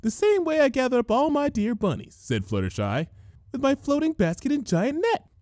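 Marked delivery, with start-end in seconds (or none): 0.0-2.2 shy pony sounding like herself again; Fluttershy voice. A narrator's reading. Fluttershy offering to round up ponies, high and gentle. 3.5-5.3 shy pony sounding like herself again; Fluttershy voice. A narrator's reading. Fluttershy offering to round up ponies, high and gentle.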